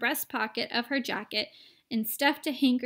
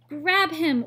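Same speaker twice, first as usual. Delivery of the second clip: much louder urgent command; order; 5 semitones higher, 9 dB louder than usual. A narrator's reading. Wonka ordering the mother to grab the boy, loud command. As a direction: bark the order. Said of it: "ordered Mister Wonka".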